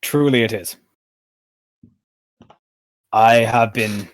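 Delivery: halting, weighty admission; grave royal speech sinking at the end; troubled city ruler. Acted. Game master speaks as Pythor.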